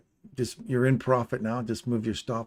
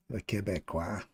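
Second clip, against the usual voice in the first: put-on Québécois accent; 3 semitones lower, 5 dB quieter than usual. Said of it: "we butcher the French language".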